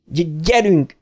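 a stammered call, pitch up; stuttered shout. A narrator's reading. Bill shouts to the gang to go. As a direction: get them moving through the stutter. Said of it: "kiáltotta Bill".

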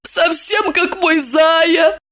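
slow, high moaning wail; tragic heroine sorrow. Acted. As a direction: moan it like a wronged wife. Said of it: "простонала она".

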